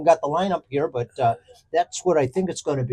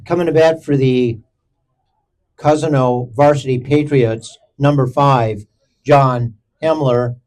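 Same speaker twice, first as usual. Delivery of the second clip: ballpark announcer; 3 semitones lower than usual. Acted. Formal batter announcement, drawn out.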